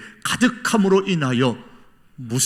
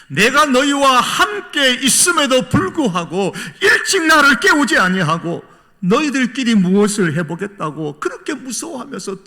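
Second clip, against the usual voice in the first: acted heartfelt anguish; 10 semitones higher, 8 dB louder than usual. Jesus grieving that disciples never woke him, anguished. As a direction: plead in frustrated concern, then let it sink. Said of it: "안타까워서 하시는 말씀입니다".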